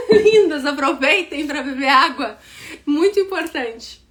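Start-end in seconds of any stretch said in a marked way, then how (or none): 0.0-0.6 a laugh breaking into lindas, higher and louder; giggling lindas. Real, not acted. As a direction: laugh it out fondly. Laughs while telling them to drink.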